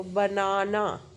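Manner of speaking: Banana slowly, with both ना drawn out; chin-drop syllable demo. Shown as a demonstration. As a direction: stretch banana so each jaw drop shows. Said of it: "chin method से देखो".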